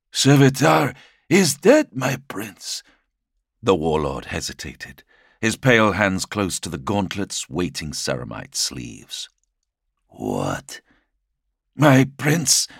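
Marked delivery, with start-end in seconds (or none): 0.1-2.8 high, loud, strained herald; high servant voice. Acted. Helath answers his lord. 10.1-10.8 low stunned lord. Acted. Primarch shocked. 11.7-12.7 high, loud deferential address; high servant voice. Acted. Helath bows.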